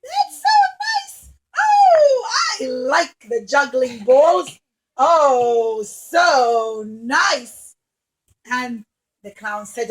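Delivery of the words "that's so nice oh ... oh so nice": delighted princess. Acted. Princess delighted by juggling balls, squealing. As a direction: gush with delight. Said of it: "she loved it".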